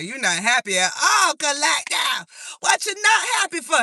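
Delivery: high, loud playful scold; hammed-up scolding of an unhappy friend; mock scolding. Mock scolding a card figure.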